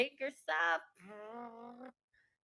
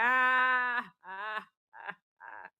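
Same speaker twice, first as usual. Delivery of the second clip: imitated crying sobs; 7 dB louder, much slower than usual. Retelling crying in the hug, sobbing sounds. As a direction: act out breaking into tears. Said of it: "Umiyak na ako".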